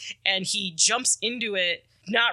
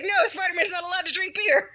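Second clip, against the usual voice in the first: much higher, near-shouted protest cracking with laughter; laughing outcry; 11 semitones higher than usual. Retold or imitated. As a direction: shriek it half-laughing like a scandalized fan.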